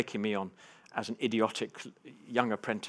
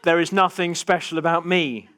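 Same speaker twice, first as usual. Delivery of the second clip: loud, high, protesting declarations; comic over-insistence; 8 semitones higher, 10 dB louder than usual. Acted. Freediver to a laughing audience, over-insistent mock defensiveness. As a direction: hammer out each phrase too emphatically.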